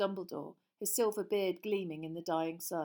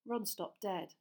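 plain reading voice

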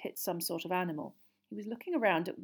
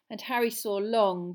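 ordinary delivery, nothing marked

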